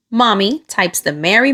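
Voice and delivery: usual speaking voice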